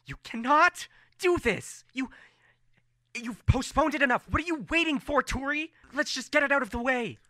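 high, exasperated yelling; Farron. Acted. Sorcerer candidate arguing with Turi, exasperated yelling. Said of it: "hear Farron yelling at Turi".

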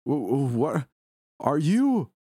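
high, alarmed stammer; stuttering panic. A narrator's reading. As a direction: stumble over the words in shock. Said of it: "I stuttered, now hyperventilating".

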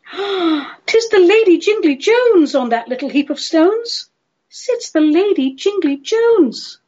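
high-pitched wonder at spotting a lady; theatrical, swooping verse character; Yonghy Bonghy Bò exclaiming. Acted. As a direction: exclaim it high, drawing out her name.

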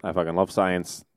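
sheepish, understated "I fucking love science"; backtracking. Shown as a demonstration. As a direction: quietly take back the rant with "I fucking love science". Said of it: "I was joking".

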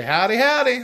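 high, loud joking howdy; jokingly. Shown as a demonstration. Showing his co-host the silly version, jokingly. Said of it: "sometimes I do jokingly say".

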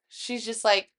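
normal talking voice

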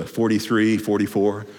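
usual speaking voice

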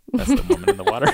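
high, laughing realization; giggling at her own mix-up. Real, not acted. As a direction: cracks up as it clicks.

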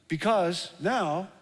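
ordinary delivery

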